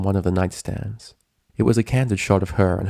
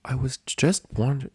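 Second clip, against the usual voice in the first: gentle nurse; 6 semitones higher, 3 dB quieter than usual. A narrator's reading. Nurse voiced higher.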